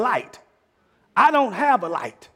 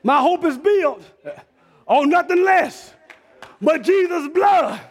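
louder, higher preaching climax with long drawn-out words; preaching at full force; 8 semitones higher, 4 dB louder than usual. Acted. Preacher urging the congregation to stand on the blood, in a full preaching climax. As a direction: drive the message home to the congregation.

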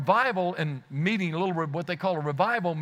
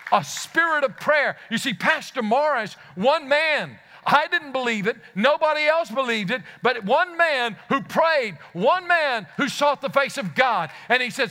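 higher, louder pulpit preaching; preaching at full force; 5 semitones higher, 4 dB louder than usual. Acted. Preacher rousing the congregation, full force.

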